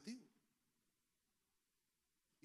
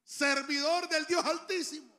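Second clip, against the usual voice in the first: a cutting, raised king's call, slow; 8 semitones higher, 10 dB louder, slower than usual. Retold or imitated. King calling down into the pit for Daniel, cutting. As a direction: call him out sharply and loudly. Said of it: "con una voz cortante dijo".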